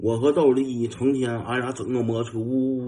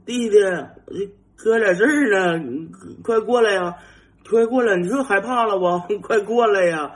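higher, louder shouts into the dark; nervous calling out; 9 semitones higher, 5 dB louder than usual. Retold or imitated. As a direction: call your friend back, covering your own fear. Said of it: "我回头我就喊道". (摩托车熄火后害怕地回头高声呼喊弟弟)

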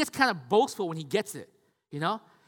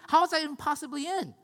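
incredulous; 8 semitones higher, 3 dB louder than usual. Retold or imitated. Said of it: "You're like".

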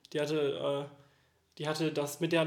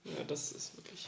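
quiet; 2 semitones lower, 11 dB quieter, slower than usual. Real, not acted. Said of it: "Warum wird's denn jetzt plötzlich so leise?".

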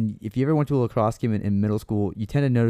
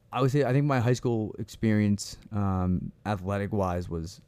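normal talking voice

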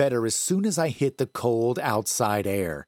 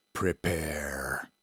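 lower, softer rasped single word; dark gritty whisper of a threat; low raspy voice; 7 semitones lower, 6 dB quieter than usual. A narrator's reading. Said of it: "in a low, raspy voice".

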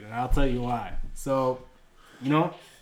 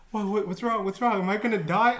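higher-pitched retold panic; re-enacted anxious pleading; freaking out; 6 semitones higher than usual. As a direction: stammer out worried questions. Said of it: "I'm freaking out at this point".

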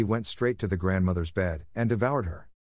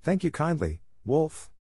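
put-on higher, sweet young girl; little girl voice; 7 semitones higher than usual. A narrator's reading. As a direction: speak up brightly like a child.

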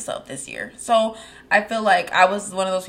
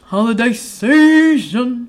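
drawn out; 2 semitones higher, 4 dB louder, much slower than usual. Acted. Drawn-out echo of topic.

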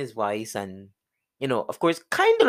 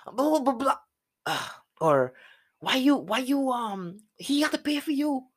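high, mocking Creole nagging; friends scolding; 12 semitones higher than usual. Friends scolding him for not calling police, high and mocking. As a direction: nag him like his friends did. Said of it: "They were like".

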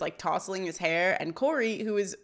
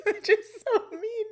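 high voice breaking up into giggles; laughing; 13 semitones higher than usual. Real, not acted. Host retells a mean joke, cracking up. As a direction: finish the quote through helpless laughter.

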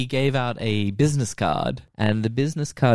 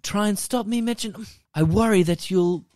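high-pitched mock villain taunt; hammed-up villain threat; villainous; 8 semitones higher than usual. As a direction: imitate a theatrical villain, pitched up. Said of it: "He was just a fucking villain".

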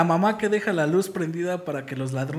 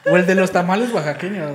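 tamale vendor call; 2 semitones higher, 7 dB louder than usual. Retold or imitated.

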